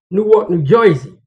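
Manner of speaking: "Noo-wuk, Noo Joisey" local accent; New Jersey accent. Shown as a demonstration. Driver imitating how Newark locals say it, New Jersey accent. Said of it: "That's how they say it".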